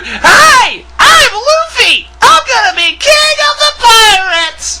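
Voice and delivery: mocking the dub's Luffy, shrill sing-song yell; squeaky Luffy. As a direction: screech it like an overexcited cartoon kid. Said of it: "better than listening to".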